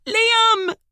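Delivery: very high loud frantic cry. A narrator's reading. Emily crying out for Liam, frantic. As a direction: scream his name in panic. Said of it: "her voice frantic".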